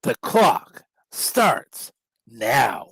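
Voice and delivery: slow dramatic catchphrase. Acted. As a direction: land the signature line slowly. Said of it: "that famous P W sixty line".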